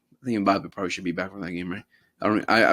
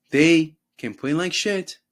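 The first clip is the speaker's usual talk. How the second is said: slow emphatic; 6 semitones higher, 5 dB louder, much slower than usual. Real, not acted. Ranting, slowed.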